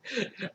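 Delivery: soft helpless chuckle; quiet real laughter; amused laugh. Real, not acted. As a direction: crack up at your own impression. Cracks up at own impression.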